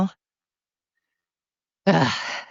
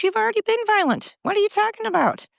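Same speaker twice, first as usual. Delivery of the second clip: much higher, incredulous outburst; outraged; 13 semitones higher than usual. Real, not acted. Vlogger snapping back at violent protesters, incredulous high outrage. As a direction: shoot up in disbelief and outrage.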